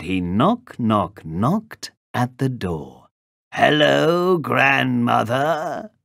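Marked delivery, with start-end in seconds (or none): 3.5-5.9 wolf calling out, raised and drawn out; growling wolf. A narrator's reading. Wolf at grandmother's door poses as the girl, growling. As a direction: growl a long, slow greeting. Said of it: "growled the wolf".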